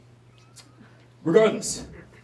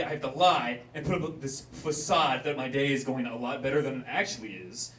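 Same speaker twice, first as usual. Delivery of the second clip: normal talking voice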